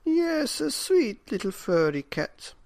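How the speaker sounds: put-on high old woman's voice, coaxing; a high old woman dreaming of a cat. A narrator's reading. Old woman coaxes husband about a sweet cat. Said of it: "said the very old woman".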